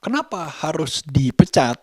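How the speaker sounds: normal talking voice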